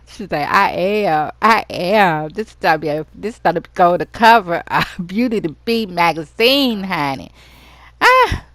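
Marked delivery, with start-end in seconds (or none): 0.5-7.3 loud, playful insisting. Pretend. Friend insists on posting. 8.0-8.5 very high loud squeal. Pretend.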